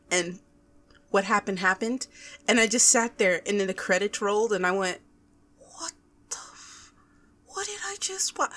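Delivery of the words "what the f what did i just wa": hushed whisper rising to a high, soft question; stunned disbelief. Retold or imitated. Viewer left stunned as the credits rolled, stunned disbelief.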